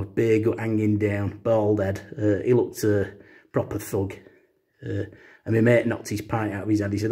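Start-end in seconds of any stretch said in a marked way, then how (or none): none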